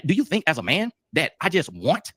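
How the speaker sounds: normal talking voice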